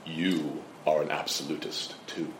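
put-on low, soft British accent; imitated British interviewer, hushed and deep; William F. Buckley. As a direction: quietly deliver the clincher in a British accent. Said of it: "in his British accent".

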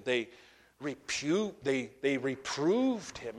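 ordinary talk

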